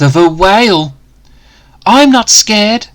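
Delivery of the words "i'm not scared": high, loud show of courage; bold declaration. Acted. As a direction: puff up and insist you're brave. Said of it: "Dan declared".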